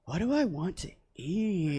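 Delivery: slow, soft, spaced-out musing; dazed. Retold or imitated. Mom asks at Taco Bell, answer dazed and slow. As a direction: drift through it like the drugs just hit.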